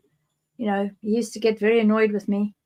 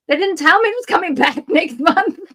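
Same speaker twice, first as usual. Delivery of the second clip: laughing through it, higher; a rueful laugh breaks in; 6 semitones higher, 3 dB louder than usual. Real, not acted. Laughs at her own ignorance.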